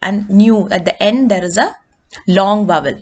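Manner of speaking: usual speaking voice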